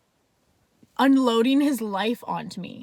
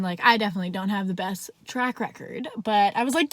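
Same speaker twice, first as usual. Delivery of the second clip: ordinary talk, nothing marked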